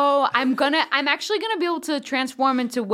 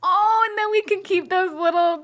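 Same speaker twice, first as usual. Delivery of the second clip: gushing, pitched up, almost crying with delight; excited planning; 7 semitones higher than usual. Real, not acted. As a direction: coo over the tiny crew. Gushing over little crew.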